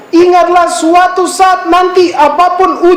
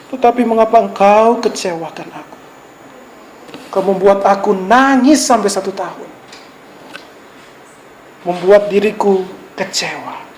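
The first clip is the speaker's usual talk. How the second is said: lower, softer heartbroken lover; hurt lover; 7 semitones lower, 4 dB quieter than usual. Acted. Preacher voicing a jilted lover, softly heartbroken. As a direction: let the hurt sink in quietly.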